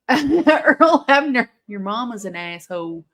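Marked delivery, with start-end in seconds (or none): 0.0-1.5 laughing. Real, not acted. Laughing at referee on screen.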